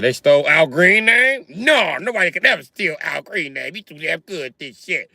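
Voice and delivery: mocking dad. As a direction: act out your disgusted father. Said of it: "I said".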